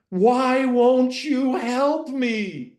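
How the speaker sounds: high, drawn-out desperate shout; long, loud, high-pitched wail of a plea; shouting after someone. Retold or imitated. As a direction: imitate an abandoned kid yelling after mom. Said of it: "he shouted after her".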